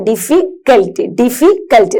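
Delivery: Difficult said in two parts with a pause before the last syllable; teacher's segmented difficult, a gap inside the word; split into parts. Shown as a demonstration. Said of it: "രണ്ട് word ഇല്ലേ?".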